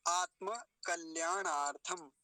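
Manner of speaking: आत्म कल्याणार्थम slow, aat-ma with voiced final a; full vowel endings. Shown as a demonstration. Teacher modelling the compound for students, slow full vowels. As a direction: sound out आत्म as aat-ma, then कल्याणार्थम slowly. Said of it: "आत्म नहीं कहेंगे".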